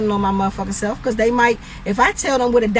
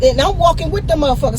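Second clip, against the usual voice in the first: ordinary talk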